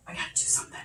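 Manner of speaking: breathy desperate whisper; hushed urgent aside. Acted. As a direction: whisper it like a secret confession. Desperate aside about marriage.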